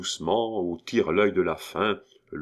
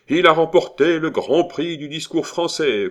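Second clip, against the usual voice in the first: high, loud acclamation; yelled praise; 9 semitones higher, 7 dB louder than usual. A narrator's reading. Cascadin acclaims the orator amid the tumult, yelling. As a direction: proclaim the winner to a roaring room.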